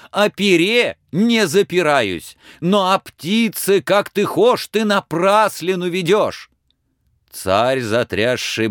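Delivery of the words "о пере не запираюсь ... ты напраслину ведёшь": high, loud, upset protest; shouting. Acted. Ivan cries to the tsar about the bird, protesting. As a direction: insist you've been wronged. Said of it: "закричал он, утираясь".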